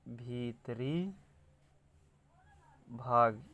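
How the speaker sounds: भीतरी drawn out softly, a long gap, then भाग; soft, stretched भीतरी, भाग set apart; slow dictation. Shown as a demonstration. Dictates phrase while writing.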